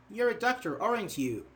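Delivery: ordinary delivery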